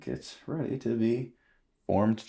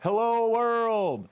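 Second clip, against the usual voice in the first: high, drawn-out hello; high, showy greeting; playful announcement; 9 semitones higher, slower than usual. Pretend. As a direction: sing it out to the mic, stretched. Playing with the microphone.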